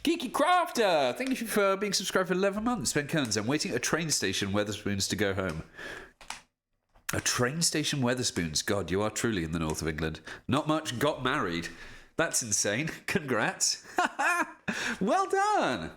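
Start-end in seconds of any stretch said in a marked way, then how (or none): 0.0-2.9 high, perky subscriber shoutout; bright thank-you. Real, not acted. 14.0-15.9 high, gushing "Well done"; high, gushing praise for a viewer; high delighted congrats. Real, not acted.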